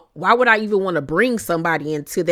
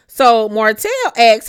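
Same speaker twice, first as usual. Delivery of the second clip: ordinary talk, nothing marked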